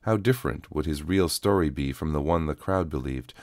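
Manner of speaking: usual speaking voice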